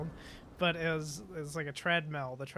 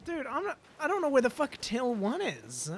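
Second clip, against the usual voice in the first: much higher, louder, incredulous complaint; exasperated; 9 semitones higher, 3 dB louder than usual. Retold or imitated. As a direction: throw up your hands in disbelief. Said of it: "I was just like".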